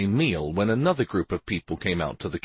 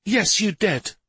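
high-pitched cross retort; exasperated comeback; snapping back; 11 semitones higher, 3 dB louder than usual. A narrator's reading. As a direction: contradict sharply, voice pitched up. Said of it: "Shari snapped back".

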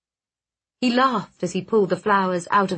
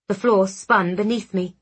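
plain reading voice

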